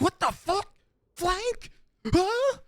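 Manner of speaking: high squeaky bewildered enemy; a caught-off-guard player yelping in shock; confused enemy. Retold or imitated. Enemy player flanked, squealing in high-pitched disbelief. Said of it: "And he'd be like".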